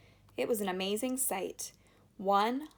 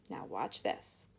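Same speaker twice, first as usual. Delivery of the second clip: hushed aside; 8 semitones lower, 6 dB quieter, slower than usual. Acted. Wonka about to show a secret.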